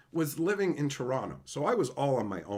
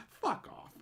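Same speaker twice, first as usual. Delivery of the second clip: unvoiced whisper; whispered fuck off; 10 semitones higher, 7 dB quieter than usual. Mouthing it at the manager, whispered. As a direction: breathe it under your breath.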